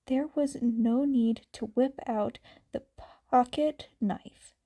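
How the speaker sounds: plain reading voice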